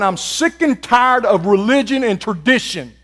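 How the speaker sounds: loud preacher's holler. Acted. Preacher voicing Jesus hollering at the church, loud and fed up. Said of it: "He's hollering".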